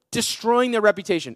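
usual speaking voice

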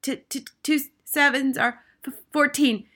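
high, stammering schoolboy; frightened, halting high voice; Rupert gasping. A narrator's reading. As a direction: gasp it out, tripping on the first sounds. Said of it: "gasped Rupert".

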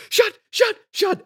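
belting out shut like the rowdy audience; mock shout. Crowd yelling at Hoffman scene.

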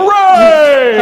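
loud high hooray; cheering. Acted. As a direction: shout it in triumph. Cheering villain reformed.

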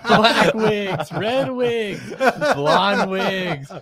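high, loud infomercial announcer; hammed-up ad pitch. Host hamming a wig commercial in a loud, high pitch. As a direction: sell the wigs like a late-night ad.

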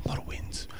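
breathy whispered echo; a secretive little whispered repeat; whispered aside. Shown as a demonstration. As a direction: whisper it to yourself as an afterthought. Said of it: "repeated after plain take".

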